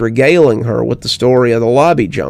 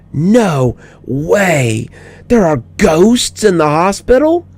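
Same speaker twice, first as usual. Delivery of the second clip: put-on high, astonished child's voice; a kid stunned by ghosts; surprised little girl; 6 semitones higher, slower than usual. A narrator's reading. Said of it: "Her mouth dropped open in surprise".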